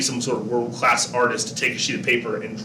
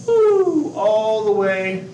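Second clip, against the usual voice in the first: high whooping woo, then a slow stretched all the way; playful woo while sliding the label; excited whoop; 9 semitones higher, 5 dB louder, much slower than usual. Pretend. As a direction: swoop the voice up like a ride. Moving the label far across.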